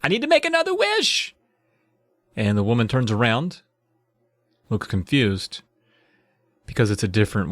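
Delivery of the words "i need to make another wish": put-on high eager girl's request; eager kid. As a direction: ask urgently like a child. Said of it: "Runs up to her".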